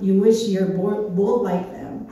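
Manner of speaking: normal talking voice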